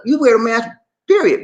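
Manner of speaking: period said high and loud; emphatic insistence. Real, not acted. Host to viewers insisting on masks, loud and final. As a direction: slam the point shut.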